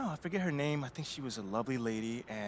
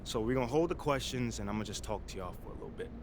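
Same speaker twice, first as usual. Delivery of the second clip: firm public address, building to passionate peaks; press conference speech; 3 semitones higher than usual. Acted. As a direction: make your case to the reporters, rising as you push. Player speaks at press conference.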